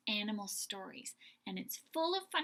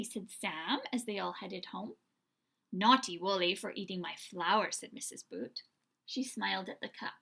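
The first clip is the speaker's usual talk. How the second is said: lively storybook read-aloud with voiced characters; animated children's story narration; 4 dB louder than usual. A narrator's reading. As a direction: read it like bedtime story time for little ones. Reads picture book aloud.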